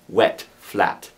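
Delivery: plain reading voice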